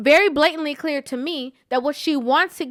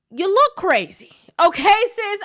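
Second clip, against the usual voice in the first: raised, higher, louder big-sister scolding; blunt callout; 8 semitones higher, 6 dB louder than usual. Real, not acted. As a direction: call her out straight, loud and high. Calling out sis directly.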